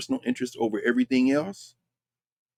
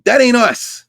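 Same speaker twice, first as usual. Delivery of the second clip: higher, louder, hammering it home; loud retort, that ain't us; loud emphatic; 10 semitones higher, 9 dB louder, slower than usual. Real, not acted. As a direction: shout it out as a flat denial.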